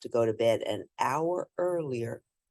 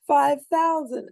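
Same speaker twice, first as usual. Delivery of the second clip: laughing; 12 semitones higher, 6 dB louder than usual. Acted. Invention name said with laugh.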